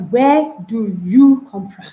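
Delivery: "Where do you come from?" dragged out, word by word, stressed on you; slow, separated words. Shown as a demonstration. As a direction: say each word apart, slowly and stiffly.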